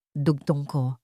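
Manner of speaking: ordinary talk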